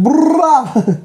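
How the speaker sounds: Punjabi cheer. Retold or imitated. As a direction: whoop it like a bhangra dancer. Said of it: "When you see Punjabi".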